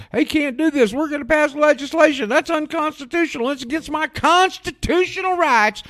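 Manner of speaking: very high, far mocking falsetto; squeaky mock patriot whine; Alex Jones. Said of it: "You're gonna hear Alex Jones and Ron Paul".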